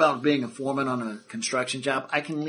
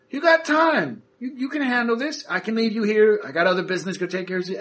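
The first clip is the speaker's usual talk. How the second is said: much higher, pushy boss voice; Terrence's voice; 7 semitones higher than usual. Acted. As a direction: brush him off and delegate. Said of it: "Terrence is like".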